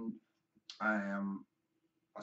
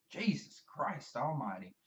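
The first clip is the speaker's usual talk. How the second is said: ordinary delivery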